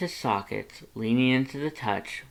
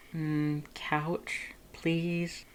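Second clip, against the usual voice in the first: higher, softer, ending in a whisper; soft whispered request; 6 semitones higher, 6 dB quieter, much slower than usual. A narrator's reading. Lust asking Red to move to the couch, soft whisper. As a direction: coax gently, almost whispering.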